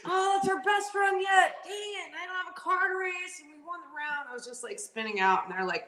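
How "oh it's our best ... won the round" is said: much higher, exasperated rant; frustrated racer. Retold or imitated. Racer after the crash rants to the team, exasperated and high. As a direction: vent the disbelief of wrecking your best run.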